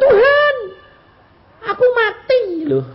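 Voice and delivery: very high, loud plea; hammed-up cry of someone about to die; mock panicked cry. Acting disciples' panicked cry.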